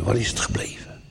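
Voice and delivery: softly voiced, dejected wondering; downcast. Preacher voices a struggling believer's doubt, downcast and hushed. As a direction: murmur it like a lost, private thought.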